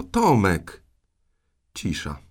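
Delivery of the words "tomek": put-on loud call for a missing boy. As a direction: call the name out forcefully. Aunt calls louder for the boy.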